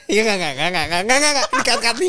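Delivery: high, loud, rapid-fire denials; flustered denial. Real, not acted. Guest teased about two wives, flustered high denial. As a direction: protest loudly in a tumbling string.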